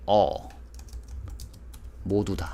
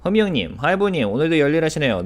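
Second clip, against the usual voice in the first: excited greeting; 8 semitones higher, 6 dB louder, much faster than usual. Real, not acted. Greeting subscribers by name, calling out.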